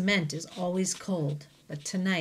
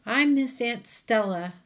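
sobbing; 5 semitones higher, 4 dB louder than usual. Acted. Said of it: "Ruby sobs".

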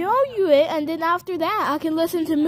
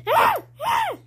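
two short, very high shrieks; short squeals. Pretend. Play tussle with Teddy.

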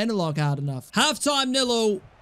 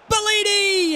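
excited shout; 12 semitones higher, 4 dB louder, slower than usual. Real, not acted. Shot on goal.

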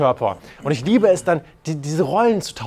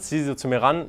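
ordinary talk

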